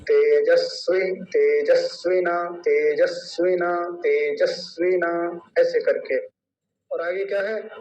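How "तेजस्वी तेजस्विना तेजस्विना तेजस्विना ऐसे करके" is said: तेजस्विना said slowly with a short वि, not drawn out; don't stretch वि in तेजस्विना; short i demo. Shown as a demonstration. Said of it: "तो क्या बोला जाएगा". (ह्रस्व इ, धीरे-धीरे)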